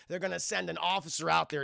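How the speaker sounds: ordinary delivery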